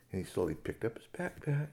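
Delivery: usual speaking voice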